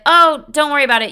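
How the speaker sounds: bright, loud, high brush-off; high loud reassurance. Retold or imitated. Reassuring date, suddenly loud.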